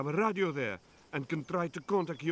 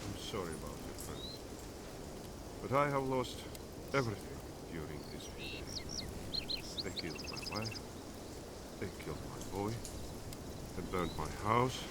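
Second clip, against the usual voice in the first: much softer and lower, heavy with grief for his wife and son; quiet grief; 6 semitones lower, 7 dB quieter than usual. Acted. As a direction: let the loss pull the voice down to a hush. Mourning his killed wife and son.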